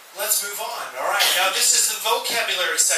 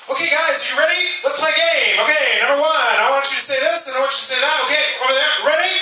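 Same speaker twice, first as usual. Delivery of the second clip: fast, loud, high hurried teacher; rushed teacher; 7 semitones higher, 5 dB louder, faster than usual. Retold or imitated. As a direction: hurry the class along, breathless. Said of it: "They'll say".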